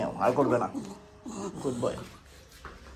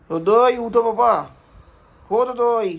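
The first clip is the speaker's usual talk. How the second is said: slow, high, loud call; calling out to wake; 9 semitones higher, 10 dB louder, slower than usual. Real, not acted. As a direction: stretch the call out to rouse her. Calling to wake someone again.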